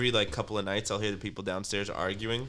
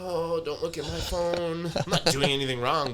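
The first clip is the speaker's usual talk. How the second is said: slow, higher whiny denial; mock defensive guy; 8 semitones higher than usual. Cheater pleading with girlfriend, slow whiny denial. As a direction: play the guilty boyfriend brushing her off.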